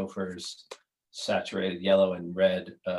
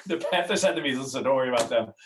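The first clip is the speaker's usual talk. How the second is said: hammed-up, higher and louder announcer call; mock announcement; 5 semitones higher, 6 dB louder, faster than usual. Host imagining a team joke, loud mock announcer call. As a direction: belt out the joke like a hockey broadcaster. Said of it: "I really wanted someone to go".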